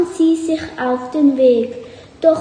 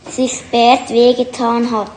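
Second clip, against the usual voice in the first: usual speaking voice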